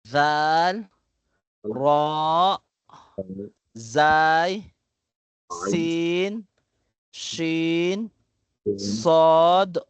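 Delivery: each Arabic letter name said slowly with pauses; alphabet recitation. Shown as a demonstration. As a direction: model the letters one by one for students. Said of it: "upang i-pronounce po lahat ng letra".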